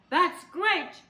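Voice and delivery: high, loud gleeful shout; gleeful cry. Acted. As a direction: cry out with glee. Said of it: "cried with glee".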